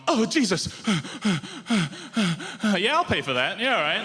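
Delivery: a shaken punter gasping then agreeing to pay; mock frightened. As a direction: act scared, then pay up happily. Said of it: "and gone".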